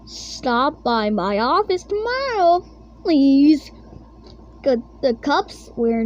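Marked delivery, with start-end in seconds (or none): none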